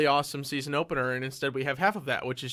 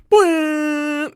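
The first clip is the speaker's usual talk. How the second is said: high cartoonish boing; siren boing; 14 semitones higher, 10 dB louder than usual. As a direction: sing out a high sproingy boing. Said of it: "clip of it just going".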